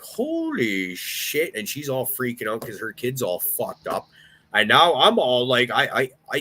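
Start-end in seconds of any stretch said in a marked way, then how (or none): 0.0-1.5 stunned. Retold or imitated. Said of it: "And I'm like".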